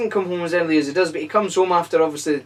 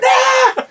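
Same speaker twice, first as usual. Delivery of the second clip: high, loud hammed-up scream; mock scream; 5 dB louder than usual. Podcaster acting the gremlin attack, hammed-up high scream. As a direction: scream like a movie victim. Acted scream in fan film.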